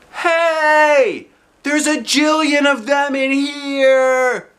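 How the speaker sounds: put-on high, slow, thrilled child's shout; excited holler. A narrator's reading. Junie B hollering at the library books, thrilled. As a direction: holler it out with delight. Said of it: "I hollered, feeling very excited".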